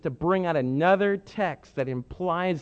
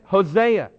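ordinary talk

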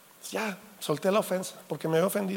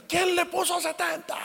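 outraged surprise; 12 semitones higher than usual. Retold or imitated. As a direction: play the father discovering the cold house, pitch jumping up. Father finds house too cold.

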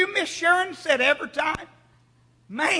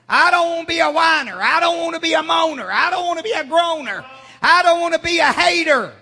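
loud building preacher cadence; fiery pulpit climax, shouting to the church; preaching at full force; 4 semitones higher, 4 dB louder than usual. Acted. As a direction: drive the refrains home louder each time. Preaching climax, loud repeated refrains.

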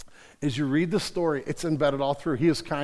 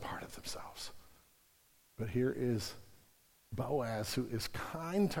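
hushed whisper; 5 semitones lower, 14 dB quieter than usual. Real, not acted. Preacher to his congregation about a hostile culture, whispered. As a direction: drop to a whisper as if confiding a hard truth.

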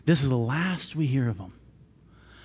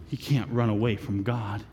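ordinary talk, nothing marked

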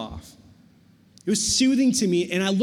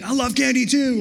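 high, loud gush of excitement; excited mock-kid enthusiasm; super hyped; 4 semitones higher, 3 dB louder than usual. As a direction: imitate getting hyped up with kids. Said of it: "we're, like, getting super hyped".

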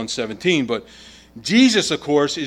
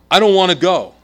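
put-on loud, higher plea of a little boy, read aloud; narrated outburst, raised in pitch and volume; mock sobbing protest; 4 semitones higher, 8 dB louder than usual. A narrator's reading. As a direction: voice a child's distressed protest without real sobs. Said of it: "The boy convulsed with sobs".

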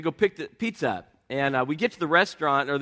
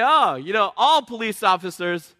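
higher, louder mock whine of a sweeping claim; mocking generalizer; 5 semitones higher, 7 dB louder than usual. Preacher mimicking a listener, mocking generalizing. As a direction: lampoon someone lumping all police together. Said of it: "You say".

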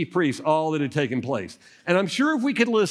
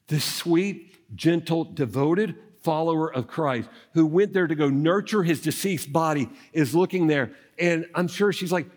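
ordinary delivery

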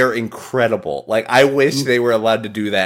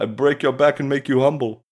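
Iron Sheik impression; 2 semitones higher than usual. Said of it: "He's like".

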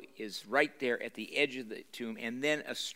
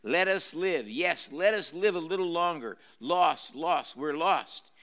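higher, louder desperate begging as Gollum; pleading Gollum; 4 semitones higher, 4 dB louder than usual. A narrator's reading. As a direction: plead like a wretched creature, slowing on the last words. Gollum pleads for his life.

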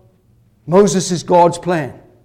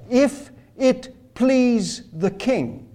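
slow, higher, softer and oily; seductive flattery; 5 semitones higher, 3 dB quieter, much slower than usual. Retold or imitated. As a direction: coax the king sweetly, drawn out. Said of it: "Haman is seductive. Haman is persuasive. That's how he talks".

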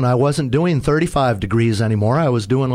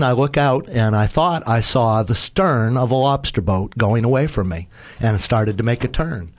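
normal talking voice

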